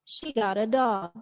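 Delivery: DOG emphasized, then falling; falling close on dog; falling intonation. Shown as a demonstration. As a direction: let dog drop to finish the thought. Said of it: "intonation went down to indicate the completion".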